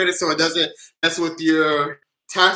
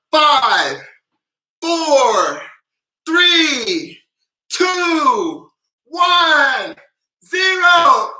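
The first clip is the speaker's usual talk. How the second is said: countdown climbing higher and louder; building countdown; 6 semitones higher, 3 dB louder, much slower than usual. Shown as a demonstration. Host counting viewers down, rising higher and louder. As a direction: build the count up to the big moment. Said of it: "When I count down from five".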